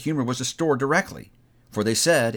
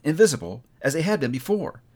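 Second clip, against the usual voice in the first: plain reading voice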